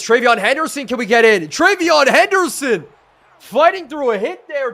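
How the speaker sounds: high, loud shout building on the name; excited touchdown call. Real, not acted. Streamer cheering Henderson toward the score, excited shout. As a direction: shout the runner into the end zone.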